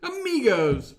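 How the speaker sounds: high, loud, slow laughing bellow; mock motivational coach. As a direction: ham up the Farley rant while laughing. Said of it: "Chris Farley motivational speaker impression".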